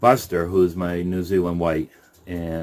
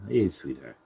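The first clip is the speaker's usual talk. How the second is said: low, softer coo; quiet sweet talk to a bunny; soft pet talk; 5 semitones lower, 7 dB quieter than usual. Real, not acted. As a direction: greet the rabbit tenderly. Greeting rabbit in hutch.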